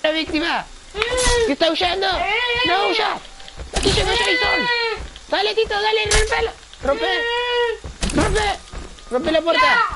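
very high, frantic yelling; raging gamer. Acted. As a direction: shriek orders at the screen. Yelling at the game character.

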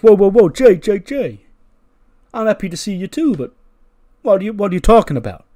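high, loud interruption; Roman Reigns. Acted. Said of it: "And Roman's like".

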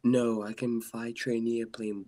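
normal talking voice

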